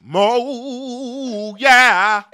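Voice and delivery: high, long wailing shout; loud drawn-out holler. Pretend. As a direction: belt it out, stretching each word. Sudden drawn-out loud call.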